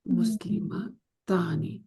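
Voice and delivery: مسلمتانی drawn out very slowly, tani held long; slow demo word. Shown as a demonstration. Teacher building the dual on the board, very slow. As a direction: let مسلمتانی unfold slowly so the ending is heard. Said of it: "dual بنا رہی ہوں".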